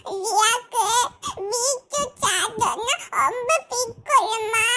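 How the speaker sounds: slow, tearful words through sobs; crying. Acted. Mother misses her daughter at dinner, crying slowly.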